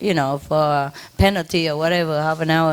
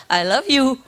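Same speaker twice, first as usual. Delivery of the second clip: talking bird; 6 semitones higher than usual. Retold or imitated. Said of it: "They even say".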